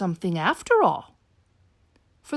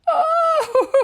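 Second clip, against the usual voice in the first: high, loud wail with a sob; blubbering orangutan; 14 semitones higher, 4 dB louder than usual. Acted. Sad orangutan wailing to Bernard, high and loud. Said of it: "wailed the orangutan".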